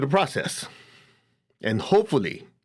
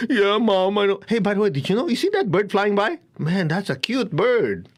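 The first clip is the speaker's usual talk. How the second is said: put-on kid dodging; 6 semitones higher than usual. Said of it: "your kids will go".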